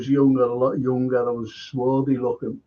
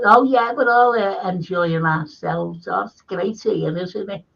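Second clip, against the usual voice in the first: put-on higher-pitched voice of chatty girls; group of girls; 4 semitones higher than usual. Girls answer the man they take for staff, higher mimicked voices. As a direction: imitate the girls' high-pitched happy reply. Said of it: "And they all".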